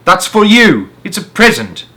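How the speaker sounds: loud, high call downward; shouting. A narrator's reading. Henry shouting down that the note is a gift. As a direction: shout it to the man below. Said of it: "Henry shouted".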